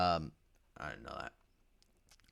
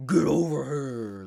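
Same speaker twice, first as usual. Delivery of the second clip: loud, slow growled battle cry; Scorpion catchphrase; 4 semitones higher, 8 dB louder, slower than usual. Retold or imitated. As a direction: bellow it like the game's spear throw. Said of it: "this classic".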